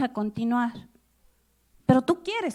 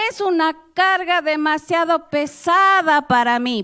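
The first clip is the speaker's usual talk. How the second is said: loud, high cry of a burdened leader; exclaimed lament; 5 semitones higher, 7 dB louder than usual. Acted. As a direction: cry it out, exasperated. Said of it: "Por qué lo digo así".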